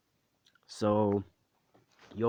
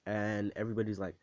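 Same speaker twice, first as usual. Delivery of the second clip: plain reading voice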